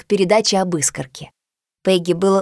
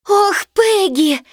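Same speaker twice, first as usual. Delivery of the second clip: high, slow relieved cry; relieved exclamation; 9 semitones higher, much slower than usual. Acted. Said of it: "воскликнула она".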